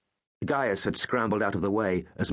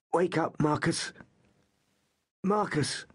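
high call to wake a friend; urgent calling; 9 semitones higher, slower than usual. Acted. Gaius tries to wake his unconscious friend, high urgent call. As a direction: call him back, pleading.

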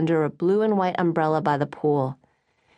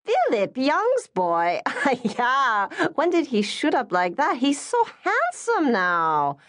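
much higher, delighted mother with a laugh; excited exclaiming; 9 semitones higher, slower than usual. Acted. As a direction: gush over the handsome boy like a thrilled auntie. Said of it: "Missus Lim exclaimed".

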